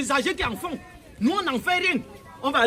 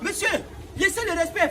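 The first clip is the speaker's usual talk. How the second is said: usual speaking voice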